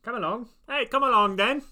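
high, hammed-up Cockney; bad Cockney accent. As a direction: put on a cheery, badly faked Cockney and call out. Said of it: "in a bad Cockney accent".

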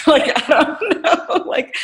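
laughing it off, pitch jumping high; laughing at herself about being loud. Real, not acted. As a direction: giggle through the words.

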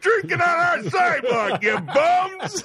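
high, hammed-up holler; re-enacted indignant yell; yelling out window. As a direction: shout down at drunks on the sidewalk. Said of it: "I yelled out my window".

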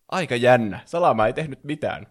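ordinary delivery

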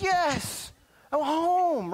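put-on high, loud child's voice, glad to be home; relieved child. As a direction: play a kid sighing with relief at the door.

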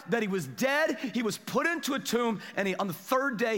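high, emphatic pulpit delivery; forceful preaching. Real, not acted. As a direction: proclaim it to the whole church.

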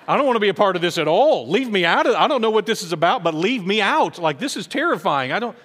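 loud, high mock panic; panicked protest. Terrified listener begging the president's party to leave, loud mock panic. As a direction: leave me out of this, almost shouting.

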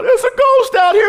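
hammed-up fright at a ghost on the lake; terrified shriek. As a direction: shriek it like grown fishermen seeing a ghost. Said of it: "they start freaking out".

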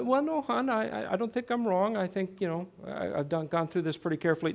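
gently disagree in an exaggeratedly patient way; mock nice tone. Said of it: "I said it in a nice tone".

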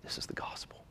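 very soft, near-whispered declaration; hushed gravity. Acted. Preacher answering the scoffing listener, hushed gravity. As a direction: let it land in a hush. Hushed reply after mocking quote.

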